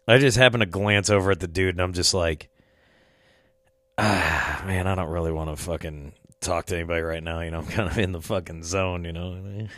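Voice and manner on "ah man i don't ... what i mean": low, quiet inner monologue; soft retold reluctance; reluctant grumble. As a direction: mumble it privately, wanting no company. Retelling his reluctance to talk.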